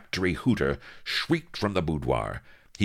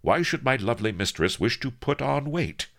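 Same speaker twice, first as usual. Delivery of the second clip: higher-pitched, artful innocent question; cunning flattery; 10 semitones higher, 3 dB louder than usual. A narrator's reading. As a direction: flatter her with a sly question. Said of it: "he sighed cunningly".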